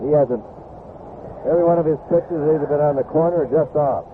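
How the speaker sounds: ordinary delivery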